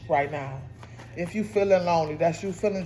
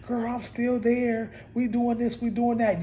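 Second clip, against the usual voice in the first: put-on higher-pitched gossipy girlfriend voice; chatty old coworker; 6 semitones higher than usual. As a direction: play an old work friend catching you up, higher. Acts out old job contacts chatting.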